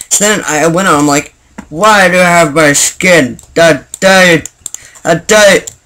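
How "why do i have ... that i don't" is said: slow, hammed-up bewildered outburst; mock outrage. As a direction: act out stunned outrage, drawing out the words. Retells his outburst at the skin.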